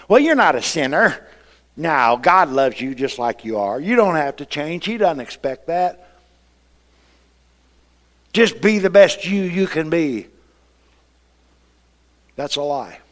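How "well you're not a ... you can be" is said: mock seeker-friendly preacher.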